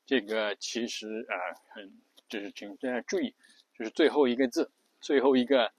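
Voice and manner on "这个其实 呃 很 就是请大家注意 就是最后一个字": normal talking voice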